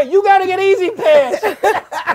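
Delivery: slow, high, loud insistence; exasperated yell. Pretend. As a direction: drum it in at comic full volume. Mock outrage, drumming it in.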